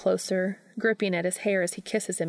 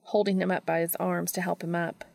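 ordinary delivery, nothing marked

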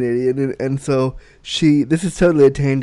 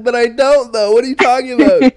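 mock indignant protest; 9 semitones higher, 7 dB louder than usual. And I was like.

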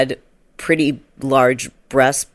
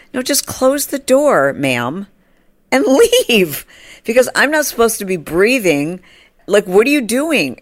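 exasperated plea; 6 semitones higher than usual. Retold or imitated. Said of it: "And she's just, like".

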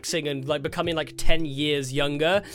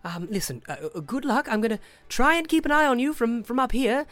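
higher, hesitant well-wisher; Tasha; 5 semitones higher than usual. Acted. Contact promising to watch from above, high and hesitant. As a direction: fumble through a flustered good luck. Game master voices Tasha.

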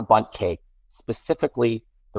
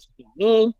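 imitating whiny child; 9 semitones higher than usual. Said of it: "And I said".

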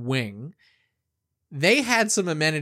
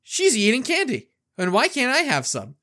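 put-on high, whiny kid voice; 10 semitones higher, 4 dB louder than usual. The boy tells his grandmother his sister has been eating candy, in a high whiny kid voice. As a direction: play the jealous little brother complaining. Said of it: "And I go".

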